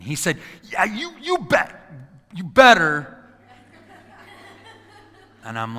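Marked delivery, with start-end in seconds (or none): 0.6-3.2 high, spluttering mock bluster; ready to fight. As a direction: splutter loudly like a thrown-off aggressor. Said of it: "he's ready to fight".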